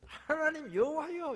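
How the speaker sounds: 하나님 cried out high and slow; overflowing cry of awe; bursting exclamation. Retold or imitated. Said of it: "감정이 터져버렸어요". (감정이 터진 외침)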